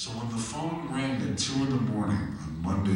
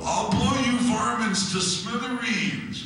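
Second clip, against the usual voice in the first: high, loud, slow cartoon bellow; Yosemite Sam; 5 dB louder, slower than usual. Comic imitating the cartoon cowboy, loud high bellow. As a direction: yell it like a hotheaded cartoon cowboy. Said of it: "He'd go".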